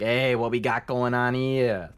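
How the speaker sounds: slow, loud tough-guy mobster greeting; slow gruff mobster drawl. Acted. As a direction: lean in like a wiseguy sizing up the room. Voicing Lenny as a mobster.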